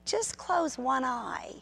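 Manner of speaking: high, soft, slow coaxing voice; sweet, lulling temptation voice; lying inner voice. Retold or imitated. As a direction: coax like a sly voice in your head. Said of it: "that voice was saying".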